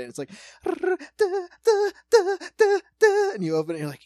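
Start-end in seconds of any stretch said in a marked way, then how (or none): 0.6-3.3 high sung da-da-da like creepy movie strings; mock horror strings. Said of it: "That's when the strings start".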